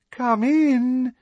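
high, loud, sickly-sweet call; sugary voice. A narrator's reading. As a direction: coo it through the door, falsely sweet. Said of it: "in a sugary voice".

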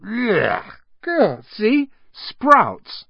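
high-pitched groan of revulsion; disgusted. A narrator's reading. Ron to Harry after biting a bad bean, disgusted. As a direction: recoil from a foul taste.

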